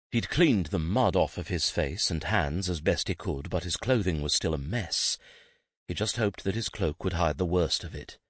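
ordinary delivery, nothing marked

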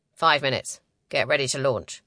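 a hint of a commander's low register; commanding male leader. A narrator's reading.

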